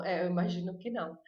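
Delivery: ordinary talk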